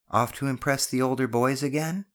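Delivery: mother catching her son sneaking out; teasing question. Acted.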